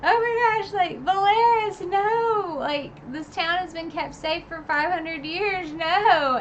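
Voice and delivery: high, loud panicked fan cry; freaking out. Retold or imitated. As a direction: shriek it like your favourite town is burning. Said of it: "I was, like, freaking out".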